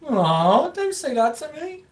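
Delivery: high, slow mock whine; whiny hurt voice. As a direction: whimper like a hurt kid. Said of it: "Their intention was for me to go".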